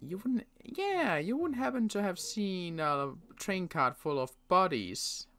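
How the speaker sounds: normal talking voice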